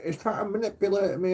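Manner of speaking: high whiny mock complaint; mock girlfriend. Girlfriend complains to police about him, high whiny mock. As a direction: whine it like a wronged victim. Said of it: "she always used to say to police".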